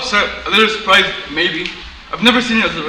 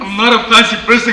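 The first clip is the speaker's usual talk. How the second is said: louder, higher protest in public; raised voice; 5 semitones higher, 6 dB louder than usual. Acted. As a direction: protest too loudly for the room. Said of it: "please lower your voice".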